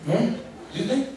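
high, loud feigned innocence, as if caught off guard; mock astonishment; mock surprise. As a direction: play dumb with a squeaky "Disney?".

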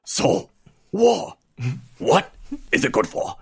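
mock Pacino growl, big pitch jumps on war; half-hammed impression of a gravelly movie star; gruff Pacino-ish quote. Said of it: "He's like".

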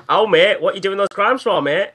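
high, loud mock British accent; exaggerated British accent, pleading with a crook; British sheriff. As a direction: play an indignant British copper, hammed up. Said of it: "British sheriff".

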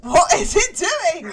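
high, loud, slow alarm. Real, not acted. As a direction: ask in nervous disbelief. Alarmed at monster.

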